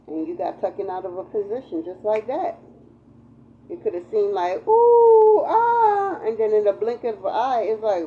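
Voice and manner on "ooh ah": high, loud mock cry of dismay; a hammed-up cry of loss; mock wail. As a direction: wail it out like the sky fell. Imagined sudden loss, crying out.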